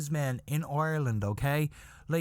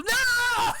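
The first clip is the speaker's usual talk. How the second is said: very high, loud drawn-out shout; hammed-up scream breaking into laughter; horrified shriek; 7 dB louder than usual. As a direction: shriek in mock horror. Women's horrified reaction to punchline.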